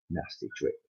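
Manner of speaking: plain reading voice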